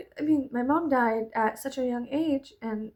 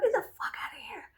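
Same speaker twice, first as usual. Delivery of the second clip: whisper; 11 semitones higher, 4 dB quieter, much faster than usual. Retold or imitated. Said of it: "I was like".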